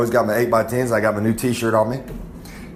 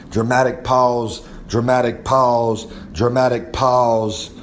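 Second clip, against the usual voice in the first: drawn-out nickname; slower than usual. Pretend. Reciting nicknames, slowing each time.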